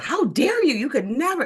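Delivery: high, loud furious outburst at a woman; lost it. Retold or imitated. Retelling how she told off the lady at the movies, furious high yell. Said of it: "and I just lost it".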